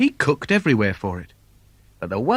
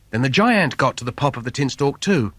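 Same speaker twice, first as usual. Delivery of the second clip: usual speaking voice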